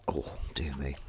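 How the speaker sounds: whispered aside. Real, not acted. Vlogger mutters to herself while washing up, whispered. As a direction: breathe it out under your breath.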